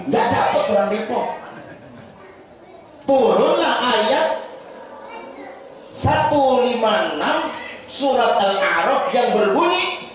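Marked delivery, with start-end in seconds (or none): 3.0-10.1 high, slow chanted recitation; solemn verse recital. Acted. Preacher recites the verse that answered him, slow and chanted.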